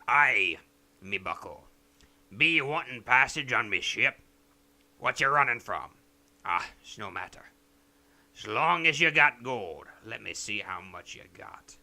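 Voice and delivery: lightly slurred gruff pirate captain; a hint of a drunk captain offering passage; drunken pirate captain. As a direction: slur it like a tipsy sea captain. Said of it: "drunkenly slurs".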